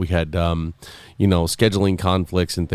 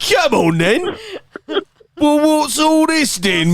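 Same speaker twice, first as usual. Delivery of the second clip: high, slow mock Cockney bobby; a put-on Cockney policeman challenging someone; Cockney accent; slower than usual. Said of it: "Cockney accents".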